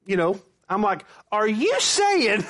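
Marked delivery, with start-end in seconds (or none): 1.3-2.4 acted disbelief. Retold challenge to the pastor.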